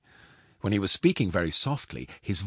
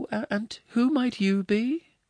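much higher, slow woman's voice; unruffled foster mother; 4 dB louder than usual. Acted. Foster mother calmly questioning the giant visitor, high and slow.

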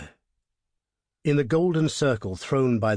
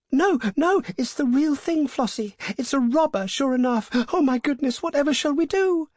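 put-on very high, panicky young woman; high-pitched, fluttering alarm; frightened squeaks; 3 dB louder than usual. A narrator's reading. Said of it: "a little crescendo of frightened squeaks".

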